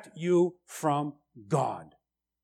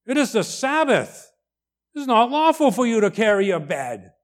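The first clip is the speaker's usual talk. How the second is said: scolding Pharisees; 7 semitones higher, 6 dB louder than usual. Retold or imitated. Pharisees scold cured man.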